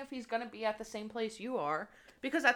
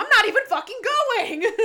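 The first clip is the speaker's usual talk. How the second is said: high, loud outraged retort; indignant yell; 11 semitones higher, 11 dB louder than usual. Real, not acted. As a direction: fire it back, offended.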